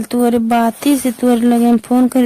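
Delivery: normal talking voice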